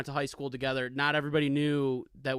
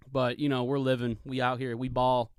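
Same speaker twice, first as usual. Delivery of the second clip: ordinary talk, nothing marked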